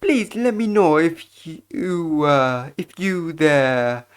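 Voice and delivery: slow, deferential hotel servant; obsequious bellboy. Acted. Bellboy offering service to an honored guest, slow obsequious voice. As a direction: draw it out with ceremonial courtesy.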